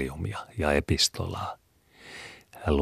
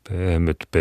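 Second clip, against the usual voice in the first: 1 strange-voiced echo. A narrator's reading. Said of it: "sanoi äiti omituisella äänellä".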